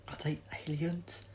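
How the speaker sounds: breathless frightened whisper; scared hushed question; gasped whisper. Acted. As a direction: gasp it in shock.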